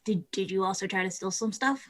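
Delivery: softly ask, stumbling on the first word; soft, tentative question with a rise at the end; quiet probing question. Acted. Player asks stranger about stealing, quiet and probing.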